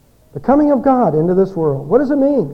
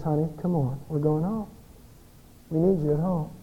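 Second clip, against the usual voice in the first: softer, tender plea to come home; gentle husband; 2 semitones lower, 9 dB quieter than usual. Acted. Husband to runaway wife, soft tender coaxing. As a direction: coax her home softly, like a relieved husband.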